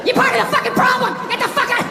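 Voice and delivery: high-pitched mock fury; indignant, hammed-up yelling; outraged accuser. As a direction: shout them down and throw them out. Outraged believer attacks the doubter.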